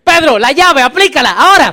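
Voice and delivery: loud joking order; mock command. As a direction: call the disciple to grab him now. Disciple ordering a headlock.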